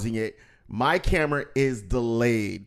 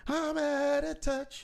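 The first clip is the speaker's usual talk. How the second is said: higher and slowed, a hammed-up lament; high, slow mock whine; 13 semitones higher, 4 dB quieter, much slower than usual. Shown as a demonstration. Host mocking himself for being out of practice, high and slow.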